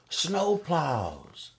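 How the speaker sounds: high excited exclamation; excited reveal. Acted. Reading to kids, thrilled snowplows finally come. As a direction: announce it like wonderful news.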